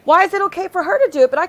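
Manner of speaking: real indignant shout at the rival; angry outburst. Real, not acted. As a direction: snap the question out in outrage. Angry rant about hypocrisy.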